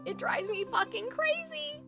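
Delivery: ordinary talk, nothing marked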